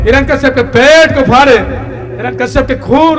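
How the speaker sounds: high, loud storytelling sermon; full-force preaching. Acted. Preacher telling the crowd of the enraged Narasimha, full-force preaching.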